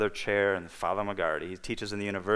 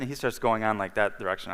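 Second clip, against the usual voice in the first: usual speaking voice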